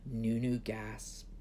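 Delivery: ordinary delivery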